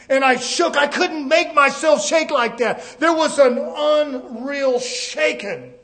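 loud, forceful testimony from the pulpit; fervent preacher's testimony; impassioned preaching. Real, not acted. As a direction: testify with full force, pitch lifted.

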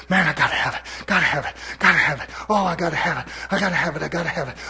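mocking a greedy churchgoer, softly; mock craving. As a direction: chant the want over and over under your breath. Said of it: "I'm mocking you now".

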